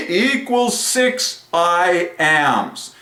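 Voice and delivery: stretched-out triumphant preaching. Real, not acted. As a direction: draw out every word as grand scripture. Proclaims conclusion and scripture.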